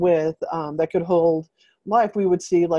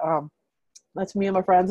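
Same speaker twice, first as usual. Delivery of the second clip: ordinary delivery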